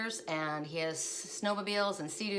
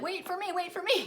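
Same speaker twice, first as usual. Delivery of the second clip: put-on much higher, squeaky baby zebra plea; little zebra calling; 12 semitones higher, 3 dB louder than usual. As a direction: call out high like a foal left behind by the herd. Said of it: "and they was like".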